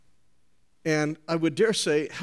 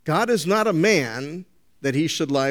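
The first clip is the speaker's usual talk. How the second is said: ordinary talk, nothing marked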